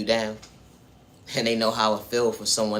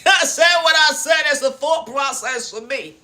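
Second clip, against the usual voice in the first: laughing, high, slow, loud declaration; high mocking proclamation that drags each word; defiant shout; 9 dB louder than usual. Real, not acted. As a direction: belt it out, proud and amused.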